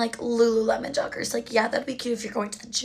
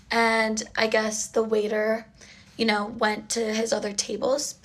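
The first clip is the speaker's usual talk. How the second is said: ordinary delivery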